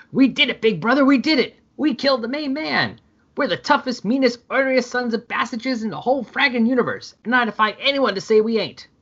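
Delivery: high, giddy victory crowing; gloating triumph. Acted. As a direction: crow it like you just won. Brothers gloating over killing Lobo.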